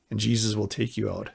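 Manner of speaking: usual speaking voice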